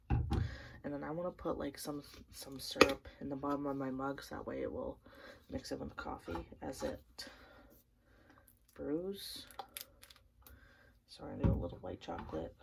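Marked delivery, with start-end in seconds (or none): none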